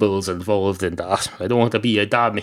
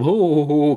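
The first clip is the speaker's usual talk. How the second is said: higher, put-on ho-ho-ho laugh; spoken fake laugh, pitched up; mock laugh; 6 semitones higher than usual. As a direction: act out a hammy story-character chuckle. Wicker man character laughs at joke.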